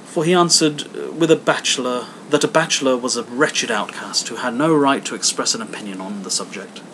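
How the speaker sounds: plain reading voice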